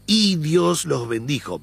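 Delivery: "Y" stressed and slowed. Shown as a demonstration. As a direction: lean on the "Y", read slowly. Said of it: "Yo voy a darle énfasis en la "y". (la "Y" enfatizada y lenta)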